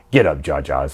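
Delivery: low, soft gruff order; gruff Tarpals. Retold or imitated. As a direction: mutter it low like a fed-up guard. Tarpals drops fake-sick Jar Jar.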